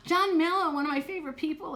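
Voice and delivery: real thrill at a favourite viewer joining; excited greeting. Real, not acted. Greeting a favourite viewer in the chat, thrilled. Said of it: "gasps at favorite viewer".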